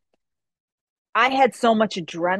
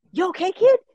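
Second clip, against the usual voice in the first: much higher, a touch louder, concerned; worried dad; 10 semitones higher, 4 dB louder than usual. Retold or imitated. Dad checks his bleeding daughter: high worried question. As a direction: ask it like a worried father.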